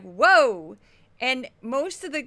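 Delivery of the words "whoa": loud, drawn-out whoa; surprised whoa. Retold or imitated. Host hearing the fired-up segment, surprised. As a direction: react like you can't believe it. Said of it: "And I was like".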